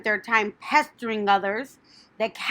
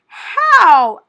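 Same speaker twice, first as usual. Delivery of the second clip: eager; 9 semitones higher, 14 dB louder than usual. A narrator's reading. Wilbur eager question.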